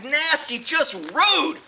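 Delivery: ordinary talk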